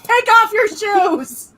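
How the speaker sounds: slow, high, laughing mock-mother yell; overacted anime dub. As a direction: shout like a scolding dubbed mother. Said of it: "my mother when I walk in".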